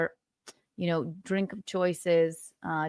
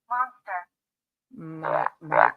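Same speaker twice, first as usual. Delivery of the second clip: monster voice; 4 semitones lower, slower than usual. Acted. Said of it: "I could go like this".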